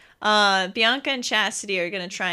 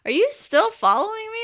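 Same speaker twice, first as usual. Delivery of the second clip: lightly voiced teenage girl, pitched up; mock high girl voice; 11 semitones higher than usual. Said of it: "She's like".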